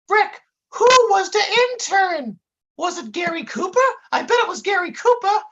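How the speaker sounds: very high, eager dimwit; Dummerton. A narrator's reading. Sidekick still asking about the intern, high goofy voice. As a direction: pitch it sky-high, pester with a guess. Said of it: "said Dummerton with a baffled expression".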